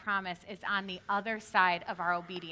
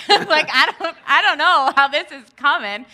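laughing, loud and higher; 6 semitones higher, 12 dB louder than usual. Real, not acted. Wife laughing at how they'd pay it, loud laughter. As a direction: laugh through the worry. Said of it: "laughing at herself".

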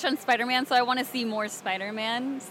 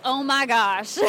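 a touch of Alabama Southern accent; 4 dB louder, slower than usual. Shown as a demonstration. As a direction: lean lightly into a Southern twang. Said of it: "How's your Southern accent?".

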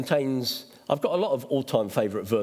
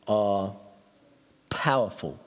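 ordinary delivery

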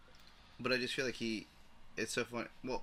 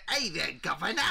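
hammed-up British accent, higher pitched; mock British accent; 5 semitones higher, 4 dB louder than usual. Imagining a comic actor's guest appearance, mock British accent. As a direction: do a silly British "governor" greeting. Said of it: "him talking in a British accent".

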